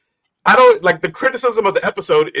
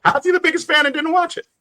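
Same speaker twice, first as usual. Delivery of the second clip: incredulous; 12 semitones higher than usual. Real, not acted. Incredulous at a friend.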